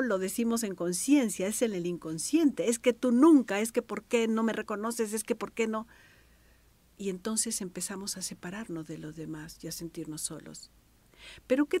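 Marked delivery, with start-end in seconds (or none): 2.7-5.9 loud, aggrieved reproach; angry reproach. Retold or imitated. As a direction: hurl the accusation like a hot-tempered person. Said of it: "lo hago de manera muy enojada, muy agresiva".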